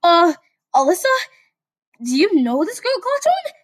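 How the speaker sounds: high, eager questioning; curious Deku. Acted. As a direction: ask with rising pitch.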